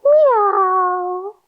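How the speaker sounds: high, long-held meow; cat meow. Shown as a demonstration. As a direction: meow high on a full breath. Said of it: "Take a deep breath in and say".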